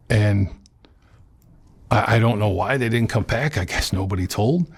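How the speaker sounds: ordinary talk, nothing marked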